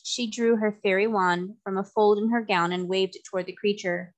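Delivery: usual speaking voice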